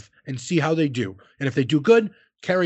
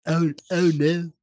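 imitated British accent; slower than usual. Copies the other's accented words.

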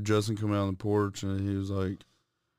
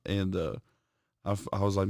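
usual speaking voice

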